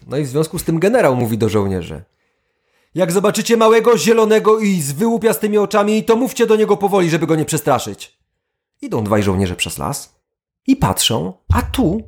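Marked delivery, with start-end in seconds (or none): 3.0-8.2 high, fast commanding officer; general giving orders. Acted. General addresses soldiers.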